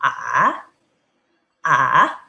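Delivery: A dipping low then rising; tone three demo. Shown as a demonstration. Teacher showing dipping A, with a little laugh. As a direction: dip A down and bring it back up. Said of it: "Tentu saja seperti ini".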